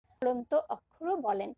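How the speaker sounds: ordinary talk, nothing marked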